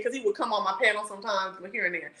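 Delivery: ordinary talk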